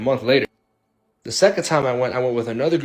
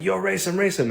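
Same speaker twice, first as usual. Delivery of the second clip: much higher, urgent "race him"; egging on; 8 semitones higher than usual. Retold or imitated. Friends urging the driver to race the car, excited. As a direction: hype your friend up to race.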